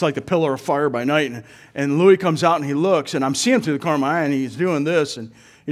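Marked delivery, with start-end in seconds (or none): none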